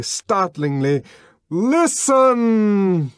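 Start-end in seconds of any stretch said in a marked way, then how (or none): 1.5-3.1 loud, high ringing command; booming summons for attention; trumpeting call. Acted. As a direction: blare it out like a trumpet. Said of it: "trumpeted the voice".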